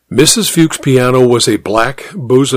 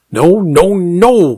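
higher, mildly cross piano teacher; an understated scolding in a raised pitch; growled objection; 5 semitones higher, much slower than usual. A narrator's reading. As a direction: cut the pupil off with a mild growl. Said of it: "Missus Fuchs growled".

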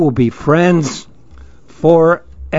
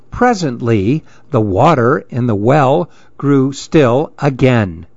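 usual speaking voice

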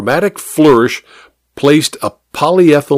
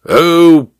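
snorting dismissal; 4 semitones higher, 3 dB louder, slower than usual. A narrator's reading. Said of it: "snorted the major".

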